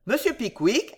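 plain reading voice

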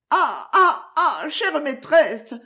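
high, drawn-out theatrical wailing; sobbing cry. A narrator's reading. Maud runs to her mistress in panic, sobbing. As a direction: wail it out slowly through acted sobs. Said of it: "s'écria Maud avec des sanglots".

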